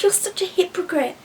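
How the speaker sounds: high-pitched exasperated accusation; worked up. Real, not acted. Ranting at meat eaters, worked up. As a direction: let indignation push the pitch up. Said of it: "I get so worked up".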